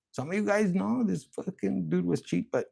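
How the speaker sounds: ordinary delivery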